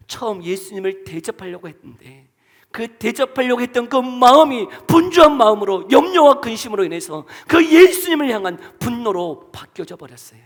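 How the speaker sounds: normal talking voice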